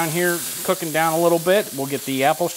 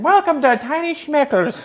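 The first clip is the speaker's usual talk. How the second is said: put-on laughing Irish accent, higher pitch; 11 semitones higher than usual. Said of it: "An Irish accent".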